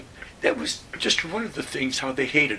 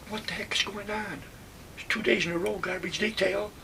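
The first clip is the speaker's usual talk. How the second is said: exasperated complaint; 8 semitones higher than usual. Retold or imitated. Buddy complaining about duty.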